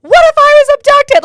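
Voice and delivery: high, full-voiced outraged yell; retold angry yelling. Upset daughter to mom who ignored her screams, yelling. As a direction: yell it at her, frantic and accusing. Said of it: "like, yelling at her".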